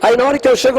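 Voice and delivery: usual speaking voice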